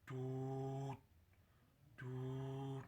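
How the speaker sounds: soft "do" held long on one steady note; a quiet, drawn-out "do", twice; long do sound. Shown as a demonstration. Coach showing the longer moan murmur, soft long do sound. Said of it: "but a little bit longer".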